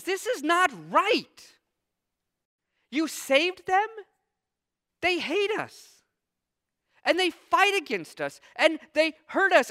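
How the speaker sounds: high, heated protest to God; indignant prophet ranting at God; angry Jonah. Acted. Preacher voicing angry Jonah.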